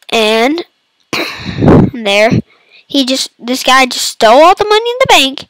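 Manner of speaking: normal talking voice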